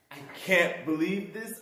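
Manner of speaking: ordinary talk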